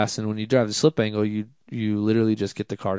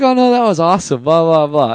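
high, loud, breezy reassurance; hammed-up cheerful dismissal; mock gushing producers; 7 semitones higher, 9 dB louder than usual. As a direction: play the producers brushing it off with gusto. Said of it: "They're like".

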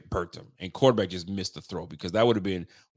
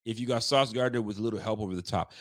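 usual speaking voice

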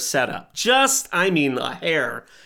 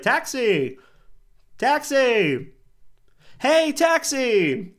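hailing a taxi; 9 semitones higher, much slower than usual. Acted. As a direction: call out to a passing cab. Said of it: "He's like".

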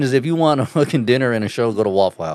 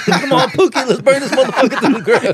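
mock furious; 12 semitones higher than usual. Friend riffing on the livid cashier, hammed-up rage. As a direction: egg on the burning, hammed-up.

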